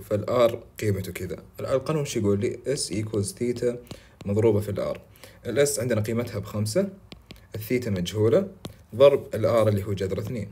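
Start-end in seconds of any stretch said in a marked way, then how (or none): 2.8-5.0 slow formula. Shown as a demonstration. Stating the formula slowly.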